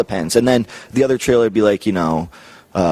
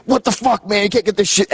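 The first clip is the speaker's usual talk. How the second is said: Scotty's outburst; 10 semitones higher than usual. Retold or imitated. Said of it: "Scotty's like".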